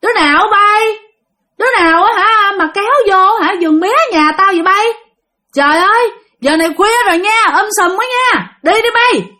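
put-on loud, high scolding old woman; yelling grandma chasing intruders away. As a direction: shout them off the field at night. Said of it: "tằng hắn rất là lớn. Với lại nói rất là lớn".

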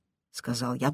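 ordinary delivery

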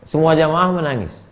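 ordinary talk, nothing marked

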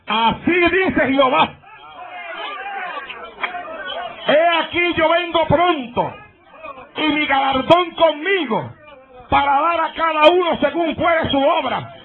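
high, slow, proclaiming like a prophet; drunk man preaching. Retold or imitated. Drunk man prophesies at the pastor, high slow proclaiming. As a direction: thunder the verse at him, slow and high. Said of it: "Y me grita".

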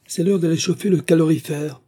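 high, loud, angry order; dry, hoarse rage. A narrator's reading. Angular elder sister to gardener, sharply ordering him to work, enraged. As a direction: narrator acting out a furious scolding. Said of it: "dit-elle d'une voix sèche, qu'une rage extrême rendait encore plus rauque".

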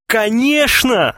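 drawn-out, high-pitched exclamation; exclaimed. A narrator's reading. Klinkov assures his future publisher, crying out. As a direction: cry it out, stretching the word. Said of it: "вскричал Клинков".

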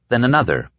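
plain reading voice

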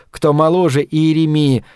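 plain reading voice

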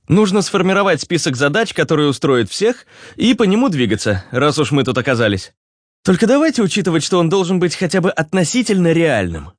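high, businesslike character voice; Руслан. Acted. (высокий, деловитый голос персонажа)

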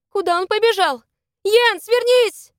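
high cry building to a loud call; alarmed calling out. A narrator's reading. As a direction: call him back desperately. Calling back a comrade under threat.

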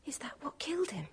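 hushed, frightened whisper; shocked whisper. Acted. Girl whispering on seeing the murder weapon. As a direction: breathe it out, stunned by the tiny weapon.

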